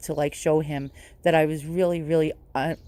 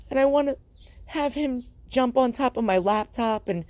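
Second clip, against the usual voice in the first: crying through the words, real grief; sobbing over a lost cat, speaking through tears; tearful crying; 7 semitones higher than usual. Real, not acted. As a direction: voice breaking up high with tears. Grieving owner after cat died.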